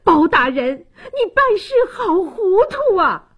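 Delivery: high, desperate mother's outcry; acted high-pitched frantic protest; frantic cry. A narrator's reading. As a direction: cry out in panic at the magistrate. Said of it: "喊道".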